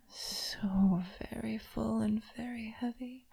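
soft unvoiced whisper. Mistress whispering to her toy, teasing. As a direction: breathe it close to the ear.

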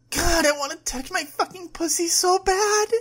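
high, loud desperate outburst; frantic scream. Acted. Woman pulling at her clothes to her girlfriend, screaming desperately. Aroused character cries out in frustration.

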